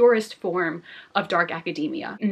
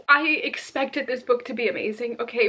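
ordinary talk, nothing marked

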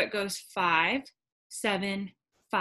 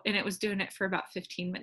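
normal talking voice

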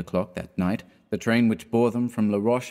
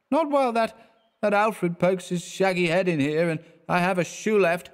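put-on much higher, slurred stubborn drunk; a tipsy man digging in his heels; drunken refusal; 9 semitones higher than usual. A narrator's reading. Drunk Clifford refuses his friend, higher and stubborn.

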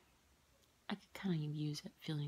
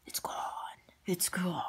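eerie whisper. Pretend. Stone drained, spooky aside.

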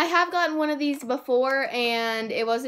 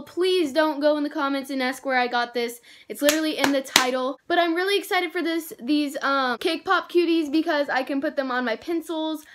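normal talking voice

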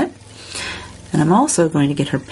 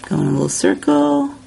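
lilting higher voice for the step; playful higher repeat of the tip; higher playful instruction; 10 semitones higher than usual. Shown as a demonstration. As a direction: sing the step out, pitched up.

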